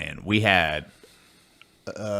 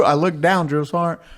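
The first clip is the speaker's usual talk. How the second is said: higher, sheepish private reply; private answering; 8 semitones higher, 3 dB louder than usual. Retold or imitated. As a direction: answer meekly. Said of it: "So I would".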